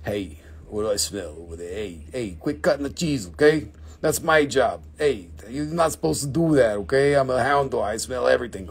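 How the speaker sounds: low goofy dog scolding; hound dog voice. Pretend. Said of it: "He's like".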